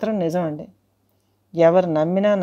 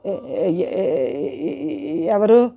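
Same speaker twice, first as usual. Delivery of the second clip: frightened stammer; much slower than usual. Retold or imitated. Terrified at the banging door.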